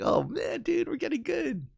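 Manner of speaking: high, drawn-out delight; acted excitement. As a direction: re-enact thrilled amazement in a high voice. Retelling their delight at improving.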